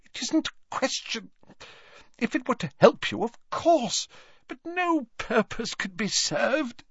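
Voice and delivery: put-on high, quick nervous old man; flustered old professor. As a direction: hurry the excuse, flustered. Slughorn flustered refusal.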